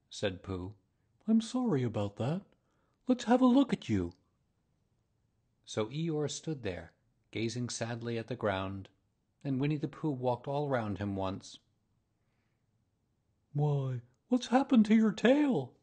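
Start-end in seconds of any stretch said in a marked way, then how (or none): 1.3-4.1 high-pitched bear voice, climbing; concerned bear. A narrator's reading. Bear offering to look over his gloomy friend, concerned. Pooh sympathises with Eeyore. 13.5-15.7 high, loud storybook astonishment; surprised. A narrator's reading. Bear noticing donkey's tail is missing, surprised. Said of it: "he said in surprise".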